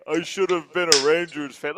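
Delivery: mildly mocking quoted voice; mock fan complaint. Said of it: "coming into my mention saying".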